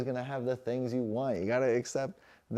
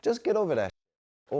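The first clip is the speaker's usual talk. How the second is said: blunt; 7 semitones higher, 6 dB louder than usual. Shown as a demonstration. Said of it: "To put it bluntly".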